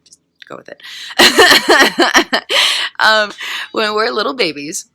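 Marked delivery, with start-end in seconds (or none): 0.9-2.9 very loud, high peals of laughter; helpless laughter. Real, not acted. Speaker amused by her baby impression, loud high laughing. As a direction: burst out laughing, unrestrained.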